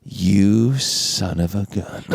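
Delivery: low, slow, drawn-out reproach; imitated simmering accusation; slow mock accusation. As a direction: draw it out as a dawning realization. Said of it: "with this look of, like".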